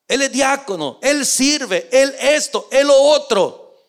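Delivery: building mock complaint. Imitating a man boasting.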